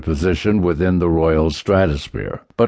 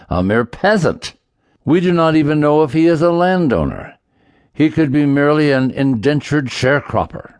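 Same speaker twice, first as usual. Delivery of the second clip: put-on higher, raised voice of a pompous old duke; 5 semitones higher than usual. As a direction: puff up like a self-important noble. Duke addresses the king pompously.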